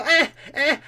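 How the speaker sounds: high repeated "eh, eh" bursts; character tic noise. Shown as a demonstration. Improviser showing how he regains his character, high "eh, eh". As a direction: click back into the character with a quick "eh". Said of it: "I can go".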